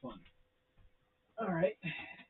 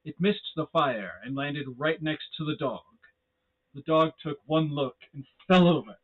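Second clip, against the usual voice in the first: louder, building spooky storyteller; dramatic horror narration; 2 semitones higher, 7 dB louder than usual. A narrator's reading. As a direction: build the dread toward the scare. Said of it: "creepy narrator voice doing this".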